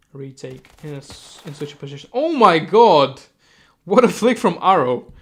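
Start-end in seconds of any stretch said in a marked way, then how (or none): 2.1-5.1 loud, high shout with a stretched "oh my god"; amazed outburst. Real, not acted. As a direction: erupt at a stunning play. Caster reacts to a flick.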